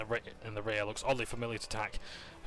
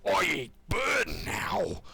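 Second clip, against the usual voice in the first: loud growl, then a much higher, drawn-out brute yell; 7 semitones higher, 7 dB louder, much slower than usual. Acted. Draconic barbarian lunging at Nero, loud growl then high yell. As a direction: roar like a dragon-blooded barbarian mid-grab.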